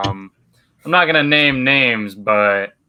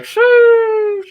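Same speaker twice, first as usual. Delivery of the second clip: Sheesh, way higher and louder; a big exaggerated Sheesh for the group; mock hype reaction; 6 dB louder than usual. Friends at the lunch table react, exaggerated high Sheesh. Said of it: "And then we're all like".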